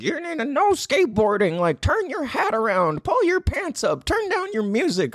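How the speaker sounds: high, mocking nagging old-person voice; impression of old people. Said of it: "so that was my impression of old people".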